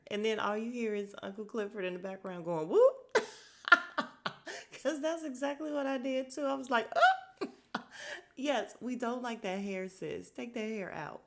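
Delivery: loud, high laughter through the words; laughing hard. Real, not acted. Reviewer cracking up at the hair line, loud laughing. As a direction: cackle along, can't keep it in. Cackling with Uncle Clifford's reaction.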